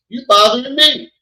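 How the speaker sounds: put-on high, loud teasing complaint to a toddler; playful mock complaint. Father teasing toddler who won't leave him alone, loud and high. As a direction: tease the little one, loud and high.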